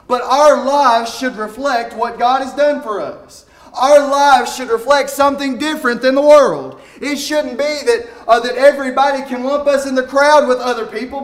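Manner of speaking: high, loud, impassioned preacher building to a peak; fiery preaching. Acted. Pastor urging the congregation to live differently, preaching loud and high.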